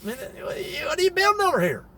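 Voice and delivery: startled holler. Retold or imitated. Mountain folk on horseback shouting at the builders. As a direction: yell across the field in surprise. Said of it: "and they're like".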